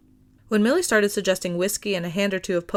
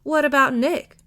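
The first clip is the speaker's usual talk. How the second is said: wheedling; 6 semitones higher, 5 dB louder than usual. A narrator's reading. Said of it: "Vash wheedled".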